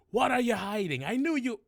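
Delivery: loud, high goblin accusation; bad-guard interrogation.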